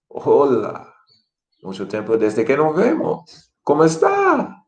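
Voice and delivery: delighted reunion, "¿Cómo está?" leaping high; long time no see, light up; with feeling. Shown as a demonstration. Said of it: "put some feeling in it".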